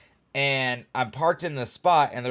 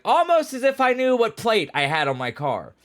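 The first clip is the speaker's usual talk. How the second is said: higher, louder mock surprise; sarcastic; 11 semitones higher, 6 dB louder than usual. Thinking at the cop who was wrong, sarcastic. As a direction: drip sarcasm at the obvious. Thinking after cop's correction.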